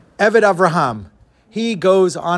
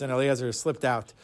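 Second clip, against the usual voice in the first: ordinary delivery, nothing marked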